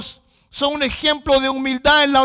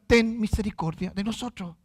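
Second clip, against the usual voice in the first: softened, lowered begging for mercy; humble plea; 4 semitones lower, 8 dB quieter than usual. Retold or imitated. Blind men beneath Christ begging mercy, humbly. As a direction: imitate a humble cry, hushed. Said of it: "humildemente le gritan".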